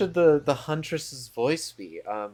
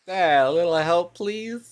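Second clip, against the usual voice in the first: higher, louder breathy-free plea ending high; chosen Huntress voice; 7 semitones higher, 8 dB louder than usual. Shown as a demonstration. Streamer settles on the Huntress voice, higher and louder. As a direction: settle on a bright, pleading huntress ask. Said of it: "That's it. There we go".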